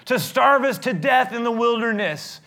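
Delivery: a crowd screaming at Moses about starving; furious complaint. Retold or imitated. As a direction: shout the blame and demand food. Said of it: "It's like".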